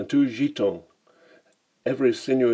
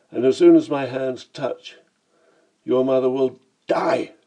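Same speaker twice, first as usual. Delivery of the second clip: loud menace building to a shouted final word; raised voice, narrated fury; furious threat; 4 semitones higher, 6 dB louder than usual. A narrator's reading. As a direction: threaten them, exploding on the last word. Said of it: "I shouted with all the fury I could muster".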